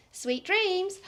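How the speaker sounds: ordinary delivery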